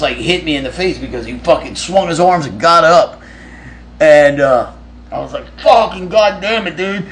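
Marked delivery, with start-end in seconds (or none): 5.6-7.1 startled curse. Retold or imitated. Punched in the nose asleep.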